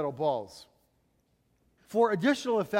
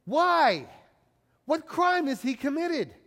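challenging question; 7 semitones higher than usual. A narrator's reading. Pilate questioning the shouting crowd, challenging and high-pitched. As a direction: demand an answer, pitched well up.

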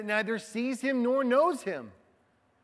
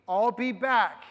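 put-on a light Schwarzenegger-style Austrian accent, louder and higher; playful, lightly done Austrian action-hero accent; 4 semitones higher, 6 dB louder than usual. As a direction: quote it with a hint of an Austrian accent. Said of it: "In his best Schwarzenegger accent".

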